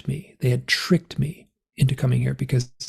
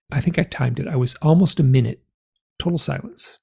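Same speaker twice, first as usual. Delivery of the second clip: usual speaking voice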